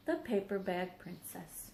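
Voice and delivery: plain reading voice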